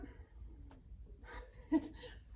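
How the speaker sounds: high airy laugh; breathy giggle. Real, not acted. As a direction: laugh at the pop. Laughs at joint pop.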